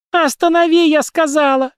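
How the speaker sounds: very high, loud scream of command; furious high-pitched bawling; wild yell. A narrator's reading. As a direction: yell it wildly at the driver. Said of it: "дико заорала Кукис".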